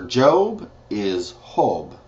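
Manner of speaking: Job said with an h sound, like Hob; teacher explaining the Spanish J. Shown as a demonstration. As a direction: soften the J into an h. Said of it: "it's pronounced as Ho".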